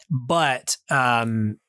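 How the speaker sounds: ordinary delivery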